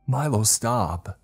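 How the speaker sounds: high, loud, drawn-out cry; alarmed shout. Acted. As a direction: shout desperately to stop him. Shouting at Milo to stop.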